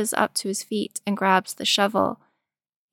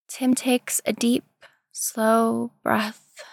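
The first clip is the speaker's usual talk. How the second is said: ordinary delivery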